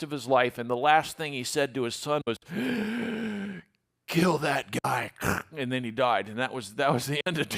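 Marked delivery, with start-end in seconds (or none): 2.4-3.6 a soft, high, rattling last gasp; dying gasp. Retold or imitated. Dying king's last breath. 4.1-5.1 slow, faint last words of a dying king; dying old king. Retold or imitated.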